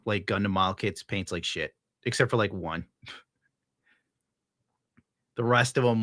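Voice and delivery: ordinary talk, nothing marked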